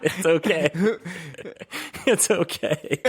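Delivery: cracking up while mimicking the drunk; high, giggly it's okay; giggling drunk. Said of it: "he just goes".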